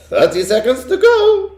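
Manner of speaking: high, loud witch call; witch crying out. A narrator's reading. Witch counting down to the boy, high and loud. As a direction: cry the countdown like a triumphant witch. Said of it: "cried the Grand High Witch".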